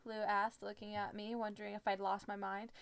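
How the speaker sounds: ordinary delivery, nothing marked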